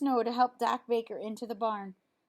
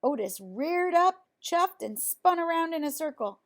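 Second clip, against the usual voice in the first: ordinary delivery, nothing marked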